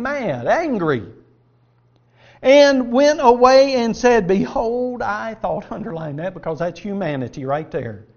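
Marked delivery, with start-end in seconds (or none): 2.4-5.7 slow, loud reading of the verse; solemn reading of Naaman's complaint; scripture reading. Acted. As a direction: read it with rising weight. Reads Naaman's words.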